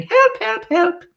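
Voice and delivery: witch mimicking panicked teachers; very high, loud mock squeals; mock yells for help. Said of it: "holding up skirts and yelling".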